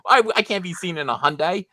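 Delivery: high, put-on spoiled kid; mock-horrified bratty complaint; whiny refusal. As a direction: complain as if mortally embarrassed. Acted spoiled child refusing a car.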